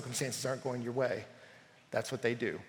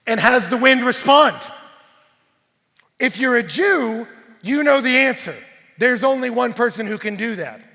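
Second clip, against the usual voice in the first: loud, raised, ringing declaration; full-force preaching; 5 semitones higher, 10 dB louder than usual. Acted. Preacher answering who commands the wind, full-force preaching. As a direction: proclaim scripture with emphatic force.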